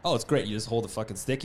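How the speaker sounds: ordinary delivery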